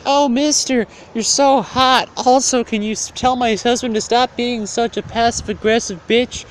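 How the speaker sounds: high flirty falsetto; mocking high-pitched wife. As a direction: put on a high, swooning woman's voice.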